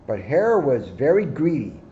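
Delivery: usual speaking voice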